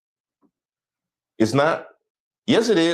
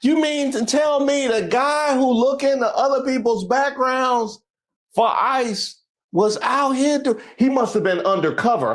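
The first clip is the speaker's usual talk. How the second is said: higher-pitched, put-on disbelief; mock incredulity; 8 semitones higher than usual. Host reacting to an arrest headline, mock incredulous. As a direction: act baffled by the news.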